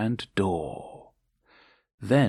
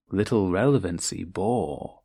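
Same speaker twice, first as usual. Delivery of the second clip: usual speaking voice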